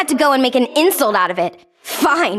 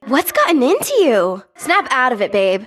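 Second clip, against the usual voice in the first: ordinary delivery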